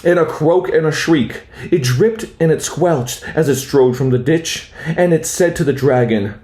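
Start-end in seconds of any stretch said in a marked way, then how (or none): none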